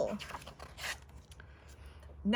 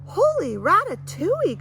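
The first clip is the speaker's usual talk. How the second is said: much higher and louder exclamation; excited cry; 8 semitones higher, 9 dB louder than usual. Acted. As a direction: burst out in astonishment. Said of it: "cried Annabelle".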